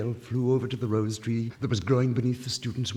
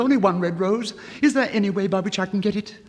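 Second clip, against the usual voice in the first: higher, louder, faster desperate plea; pleading cry; 10 semitones higher, 9 dB louder than usual. Acted. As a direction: beg urgently for the rose. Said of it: "cried the nightingale".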